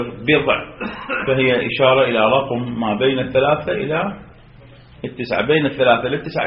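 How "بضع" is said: «بضع» with a short i after the ب: biḍʿ; كسرة على الباء. Shown as a demonstration. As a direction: say «بضع» as biḍʿ, i vowel on the first letter. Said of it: "أما بالكسر". (نطق «بضع» بكسر الباء)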